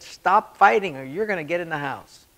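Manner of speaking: dad's second warning, loud and stern; firmer warning. Retold or imitated.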